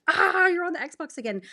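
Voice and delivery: high, exasperated complaint; fed-up wife teasing her husband; annoyed nag. Retold or imitated. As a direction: nag him about the Xbox again. Irritated quote of herself to husband.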